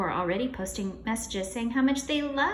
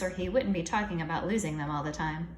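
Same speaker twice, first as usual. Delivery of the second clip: usual speaking voice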